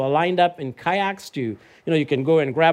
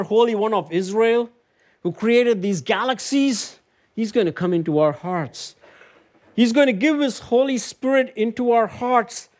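preaching at full force; 8 semitones higher than usual. Acted. Preacher to congregation, proclaiming Christ with rising fervour.

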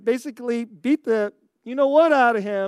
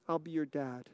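tender offer; 7 semitones lower, 13 dB quieter than usual. Retold or imitated. Miyagi's offer to fatherless boy.

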